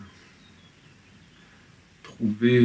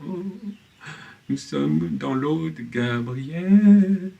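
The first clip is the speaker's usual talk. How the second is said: high, slow, drawn-out singsong swoon; mocking lofty imitation; 6 semitones higher, much slower than usual. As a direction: mimic their rapturous claim, stretching the words out high. Mocking rivals' lofty claim, acted quote. (imitation moqueuse, voix haute et extasiée)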